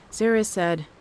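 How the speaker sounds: ordinary delivery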